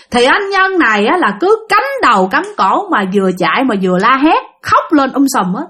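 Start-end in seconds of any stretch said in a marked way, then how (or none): none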